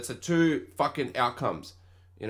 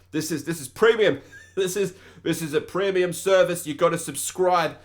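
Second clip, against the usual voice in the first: a put-on salesman voice, louder, pitched higher, laughing through it; mock salesman; 6 semitones higher, 5 dB louder than usual. Teasing a lady with a put-on salesman pitch. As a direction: sell her the premium service like a cheesy salesman.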